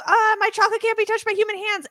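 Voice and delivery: high-pitched mock upset; imitated fussing, high and agitated; mad Wonka. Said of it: "he's, like, mad, Wonka".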